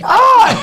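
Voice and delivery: high, loud imitated scream of fright; sudden mock shriek of shock; startled scream. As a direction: scream like someone jumped you. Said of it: "He screams".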